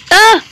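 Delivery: high, loud shriek; terrified scream. Real, not acted. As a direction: shriek in fright. Caught by dinosaur.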